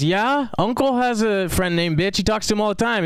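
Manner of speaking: a hint of a small girl's voice; little sister voice. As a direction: play the little sister casually reporting news. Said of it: "She goes".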